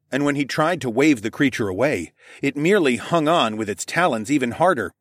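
plain reading voice

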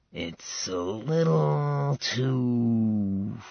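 very slow, soft, words pulled long; stretched out. A narrator's reading. Styx unsure about the empty palace, slowly stretched. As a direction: let it trail out slowly. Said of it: "stretching the words out".

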